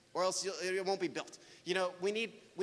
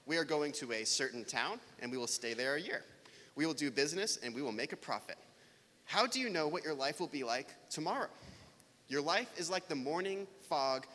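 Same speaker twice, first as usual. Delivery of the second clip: measured Bible reading, settling lower toward each verse end; scripture reading; 4 semitones lower than usual. Acted. Pastor reading James four to the church, measured and solemn. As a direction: read the passage aloud to the congregation.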